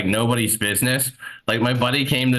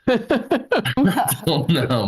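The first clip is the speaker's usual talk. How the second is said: laughing; 8 semitones higher, much slower than usual. Real, not acted. Laughs at his own joke.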